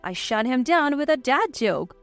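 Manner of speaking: ordinary delivery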